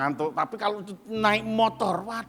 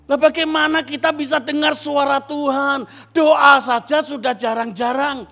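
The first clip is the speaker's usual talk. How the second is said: loud, high preaching rebuke; full-force sermon rebuke; rebuking; 9 semitones higher, 7 dB louder than usual. Real, not acted. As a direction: scold the church for laziness. Rebuking congregation.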